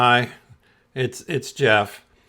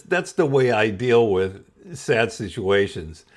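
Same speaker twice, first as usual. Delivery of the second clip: usual speaking voice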